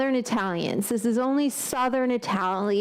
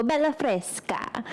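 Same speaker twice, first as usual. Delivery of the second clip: Italian exclamation; 4 semitones higher, slower than usual. Retold or imitated. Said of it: "You go".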